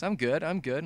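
ordinary talk, nothing marked

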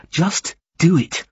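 high, slow emphatic command; fierce. A narrator's reading. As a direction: spell out the order fiercely, drawn out. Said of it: "she repeated fiercely".

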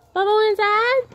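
sing-song pet voice, rising up high; high pet talk. Real, not acted. Coaxing cat to come inside.

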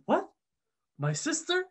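high-pitched incredulous question; astonished, rising sharply; retold disbelief. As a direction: echo the news back in mock shock, voice leaping up. Omar retold, shocked by the news.

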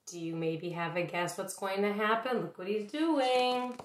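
plain reading voice

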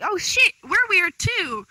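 high, loud, delighted exclamation; excited welcome. Retold or imitated. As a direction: act out friends cheering a newcomer in. Acting out friends' excited welcome.

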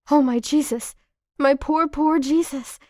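loud and high, breaking into sobs; sobbing cry. A narrator's reading. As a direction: wail it through sobs. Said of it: "I sob uncontrollably now".

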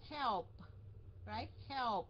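HELP drawn out to a finished LP; có âm cuối. Shown as a demonstration. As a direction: model the ending of HELP. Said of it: "có cái âm ở phía sau". (đọc "help" rõ âm cuối)

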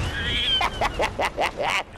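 staccato cackling laugh; gleeful laugh. Real, not acted. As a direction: laugh in a rapid gleeful burst. Gloating while beating up game enemies.

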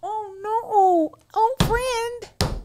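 high, cooing pity; mock tearful sympathy. Comforting a crying angry friend, mock tearful sympathy. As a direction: coo over a friend in mock distress.

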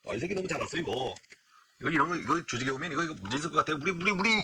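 very soft, conspiratorial aside; hushed grumbling. Acted. Coworkers sizing up a show-off newcomer among themselves, hushed grumbling. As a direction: mutter it low, out of the newcomer's earshot.

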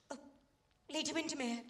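ordinary delivery

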